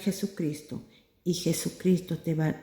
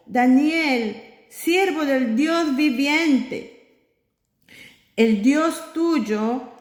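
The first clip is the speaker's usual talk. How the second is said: slow, higher, sorrowful call; king calling to Daniel in the pit, slow and sad; 6 semitones higher, 3 dB louder, slower than usual. Acted. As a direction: call down into the pit, full of worry. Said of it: "llamó a voces a Daniel con voz triste".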